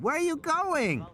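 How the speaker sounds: put-on high child's call; little girl calling. Lex calls to Ed Regis leaving the car, child's call. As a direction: call after him like a small girl. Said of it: "Lex said".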